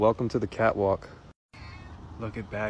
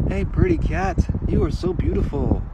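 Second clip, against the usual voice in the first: hey squealed very high, then louder praise; cooing pet talk; 6 dB louder than usual. Real, not acted. Greeting a pretty stray cat, high-pitched cooing.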